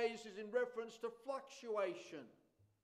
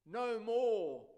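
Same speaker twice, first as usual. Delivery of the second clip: "No more" thrown out loud, more hit hard; emphatic no more; 6 dB louder than usual. Shown as a demonstration. Preacher driving the point home to listeners, loud hammered more. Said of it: "I love that emphasis there".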